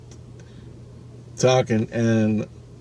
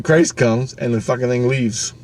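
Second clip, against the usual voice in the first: usual speaking voice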